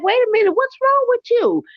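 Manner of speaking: high, startled demand; shocked. Retold or imitated. Family storming in, shocked high demand. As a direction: jump up in alarm at her. Family confronting brother's wife.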